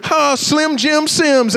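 high, slow mock cheer; teasing girl. Girl teasing him as he rounds the bases, mock cheer. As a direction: stretch out the nickname as an imitated taunt. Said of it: "she was laughing as I was going".

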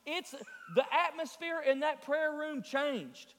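rousing sermon delivery; impassioned preaching. Real, not acted. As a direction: preach it urgently to the whole congregation. Preacher at full force.

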